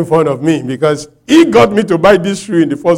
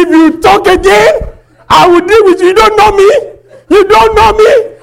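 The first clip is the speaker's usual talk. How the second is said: shouting, high and loud, threatening; furious threat; 10 dB louder than usual. Retold or imitated. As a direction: yell it like you've lost your cool. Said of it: "I lost control of myself".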